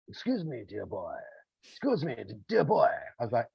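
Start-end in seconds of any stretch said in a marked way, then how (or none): 0.1-1.2 quietly imitating an old-fashioned posh English gent; a soft, gentle "Excuse me, dear boy"; old style English. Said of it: "very old style English". 1.6-2.9 put-on a fuller, more emphatic "Excuse me, dear boy"; old style English. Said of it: "very old style English".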